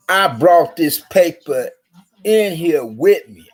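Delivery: slow mock insistence. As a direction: spell it out slowly to her. Retold line at register.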